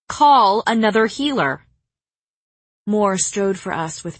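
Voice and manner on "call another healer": louder, higher repeated order; loud insistence. Acted.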